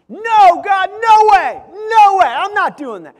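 shouted high refusal, no way; defiant shout. Retold or imitated. Jonah shouting his refusal at God, loud and defiant. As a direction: yell it back at God, flat no. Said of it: "We don't even read Jonah saying".

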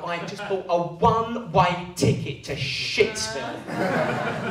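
ordinary delivery

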